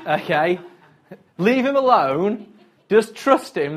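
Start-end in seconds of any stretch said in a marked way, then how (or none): 1.4-3.8 firm plea. Real, not acted. Exhorting congregation firmly.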